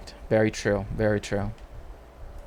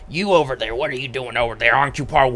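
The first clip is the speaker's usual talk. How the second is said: lightly voiced heckling actor calling out a spectator; a hint of a ranting conspiracy heckler; mock accusing heckler; faster than usual. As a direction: tease a spectator from the stage, half in jest. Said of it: "maybe not in the Alex Jones voice".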